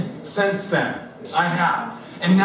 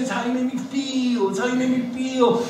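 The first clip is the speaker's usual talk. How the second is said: wife repeating; 6 semitones higher than usual. Retold or imitated. Wife repeating her line.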